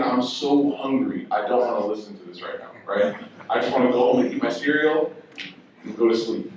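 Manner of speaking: tired inner voice. Retold or imitated. Inner thoughts, tired, low.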